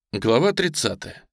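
ordinary delivery

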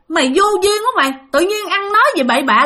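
high, fast mock scolding; a fast retold telling-off; scolding outburst. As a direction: snap at him like an irritated big sister. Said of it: "mẹ mới nói".

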